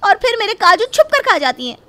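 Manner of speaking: loud, high, defiant shouting; wife yelling back at her husband, loud and high. Acted.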